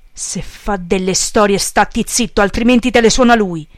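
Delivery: loud shout, building in force; yelled. A narrator's reading. Boss warns follower not to fight the priest, yelled. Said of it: "urlò Peppone".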